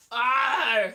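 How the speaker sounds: loud throaty pirate "ah"; pirate cry. Shown as a demonstration. Comic straining own voice as pirate, loud growl. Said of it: "that really does your voice in".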